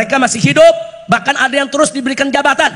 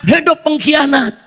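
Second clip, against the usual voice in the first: usual speaking voice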